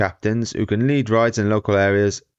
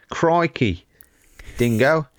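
hammed-up Australian accent, pitched way up; Aussie accent; 5 semitones higher, slower than usual. To Tom, showing off the terrible accents in an Australian accent. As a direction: play the broad Aussie bloke. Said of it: "That was the Aussie one".

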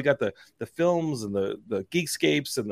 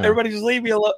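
mock yell; 9 semitones higher, 3 dB louder, much faster than usual. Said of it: "I wanted to yell".

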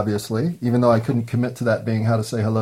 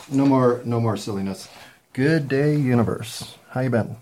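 usual speaking voice

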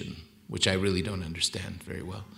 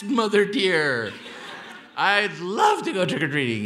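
mock-sweet child delighted by the offer; eager little boy; 8 semitones higher, 3 dB louder than usual. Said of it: "I said".

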